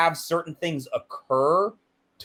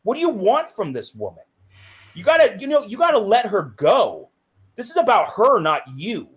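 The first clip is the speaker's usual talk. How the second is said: high, insistent retold lecture; exasperated scolding; 6 semitones higher, 4 dB louder than usual. Child urging mom to let grandma go, exasperated. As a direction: press the point home emphatically.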